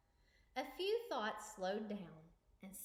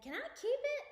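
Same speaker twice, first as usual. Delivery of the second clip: excited; 8 dB louder than usual. Acted. Excited child asks for jar.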